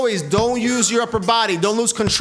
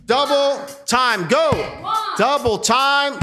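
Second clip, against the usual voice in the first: coach shouting; 6 semitones higher, much slower than usual. Real, not acted. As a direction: shout the cue to keep them going. Coach calling out double time.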